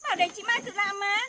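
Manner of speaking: loud, fast, high scolding. Real, not acted. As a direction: tell them off sharply and quickly. Scolding sibling for heavy load.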